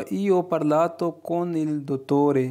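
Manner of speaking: very slow, each Italian word laid out clearly; slow model reading. Shown as a demonstration. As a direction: read it slowly for learners to copy. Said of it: "teacher models Italian sentence". (धीरे-धीरे साफ़ उच्चारण)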